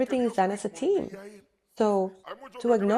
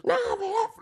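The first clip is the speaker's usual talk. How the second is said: high-pitched mock protest; protesting husband; 13 semitones higher, faster than usual. Preacher playing the worked-up man answering his wife, protesting. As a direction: interrupt in a high, agitated acted voice.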